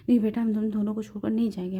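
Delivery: ordinary delivery, nothing marked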